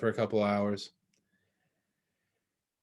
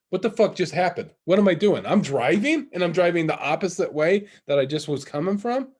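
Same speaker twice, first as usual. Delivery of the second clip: higher, louder baffled questions; acted bewilderment; 6 semitones higher, 5 dB louder than usual. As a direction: replay sudden alarmed confusion. Acting out coming to while driving.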